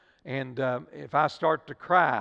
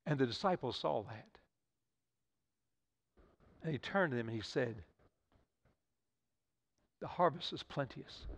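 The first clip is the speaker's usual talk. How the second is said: hushed; 5 semitones lower, 14 dB quieter than usual. Acted. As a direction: pull the room in with a quiet voice. Hushed climax of sermon story.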